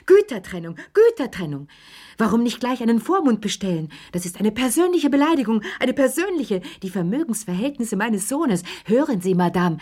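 high, fast, shrill-free mock of an indignant mother; mocking Madame Pelloux. Chérie mimics his mother scolding Lea, hammed-up high voice. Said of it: "damit ich Madame Pellouxs Arme nachmachen kann".

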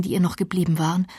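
usual speaking voice

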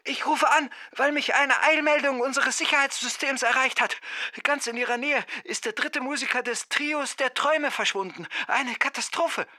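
very high, urgent caller; distant phone voice. A narrator's reading. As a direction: report an emergency down a poor phone line. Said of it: "am anderen Ende nicht sehr gut zu verstehen". (sehr hohe, ferne Telefonstimme, dringlich)

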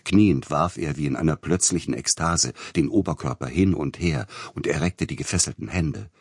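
plain reading voice

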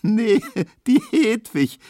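high squeaky laughing squeal; girl squeals with laughter at her friend's joke. Acted. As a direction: squeal with giggles. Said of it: "quiekt Emma".

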